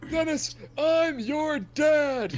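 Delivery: slow drawn-out proclamation; dramatic declaration. Acted. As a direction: stretch it out like a grand reveal. Old man's dramatic claim.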